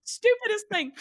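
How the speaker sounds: high, loud, laughing through the words. Real, not acted. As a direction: burst out amused at your own blunder. Laughs over their truck blunder.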